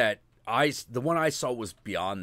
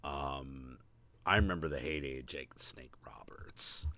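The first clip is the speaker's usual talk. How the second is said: whispering; 8 semitones lower, 12 dB quieter than usual. Real, not acted. Awed aside to himself.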